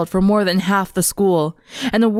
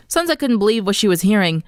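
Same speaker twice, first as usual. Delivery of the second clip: ordinary delivery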